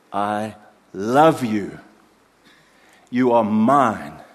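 slow, loud, tender I love you; slow tender declaration; tender father's voice. Retold or imitated. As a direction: linger on each word like a loving father. Loving father's words voiced.

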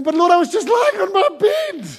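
very high, comic wail to God; hammed-up squeaky complaint; whining protest. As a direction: whine it high, hard done by. Mock complaint to God retold.

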